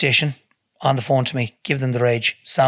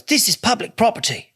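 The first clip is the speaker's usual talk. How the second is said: high-pitched mock English accent; imitated English accent; 9 semitones higher than usual. The stranger arguing back about the school, in a high English accent. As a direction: mimic the man in an English accent, pitched well up. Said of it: "he's an English accent".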